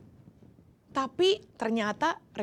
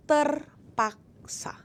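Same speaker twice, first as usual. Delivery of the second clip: Terpaksa whispered slowly, breathy and drawn out; whispered word; 4 semitones higher, much slower than usual. Shown as a demonstration. Preacher dwelling on the word Terpaksa, slow whisper. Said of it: "terpaksa aku berteriak".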